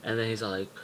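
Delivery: ordinary talk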